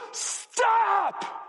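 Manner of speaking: high, urgent parental yell; top-of-the-lungs yell. Acted. Parent stopping a child running into the street, yelling. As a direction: yell it as one long, urgent warning. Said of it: "yell at the top of your lungs".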